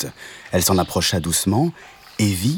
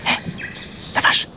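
whispery insect hail; tiny little voice; faster than usual. Acted. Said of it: "une toute petite voix retentit".

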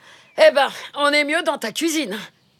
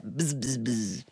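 bourdonnement qui s'éloigne; 12 semitones lower, 10 dB quieter, slower than usual. Acted.

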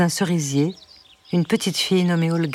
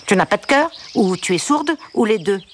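a wounded duck snapping back, nasal; annoyed nasal duck; 4 semitones higher than usual. A narrator's reading. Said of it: "le canard s'énerva".

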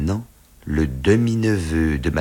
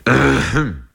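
self-important "ahem"; deliberate throat clear before announcing; 7 semitones higher, 6 dB louder than usual. A narrator's reading. Father coughs before revealing who he is, deliberate throat clearing. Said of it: "Il toussa légèrement".

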